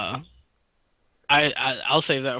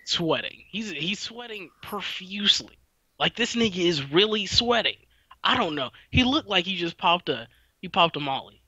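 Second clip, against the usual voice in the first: ordinary delivery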